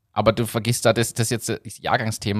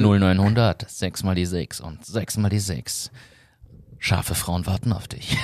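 slow, low, seductive ad voice; sultry hotline ad; 3 semitones lower, slower than usual. Retold or imitated. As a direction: purr the number like a late-night ad. Mimicking a hotline TV ad.

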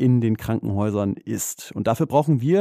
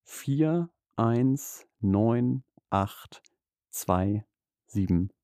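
very slow, each digit apart; over enunciating; 4 dB quieter, much slower than usual. Shown as a demonstration. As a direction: spell out every digit slowly for dictation. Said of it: "ganz langsam zum Mitschreiben".

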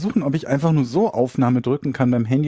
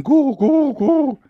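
pigeon coo; 9 semitones higher, much slower than usual. Retold or imitated. Imitating pigeons while listing birds.

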